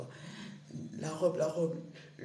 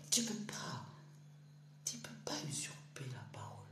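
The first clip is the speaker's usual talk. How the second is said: hushed whisper, much softer; 5 semitones lower, 8 dB quieter than usual. Real, not acted. Preaching to his viewers, he drops to a hushed whisper. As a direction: breathe it like a secret.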